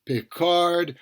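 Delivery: ordinary talk, nothing marked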